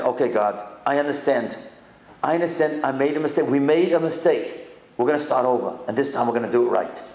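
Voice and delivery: low, humble resolve before God; calm acceptance. Acted. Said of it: "That's not what Rabbi Akiva does".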